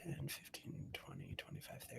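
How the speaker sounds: very soft, low mutter to self; counting under breath. Real, not acted. Game master counting out movement, muttered under breath. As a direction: count off squares barely audibly.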